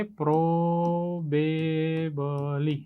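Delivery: probably stretched slowly as "pro-ba-b-ly"; slow classroom sounding-out of probably, syllable by syllable; drawn-out syllables. Shown as a demonstration. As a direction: hold each syllable of probably long and level. Teacher sounds out a new word.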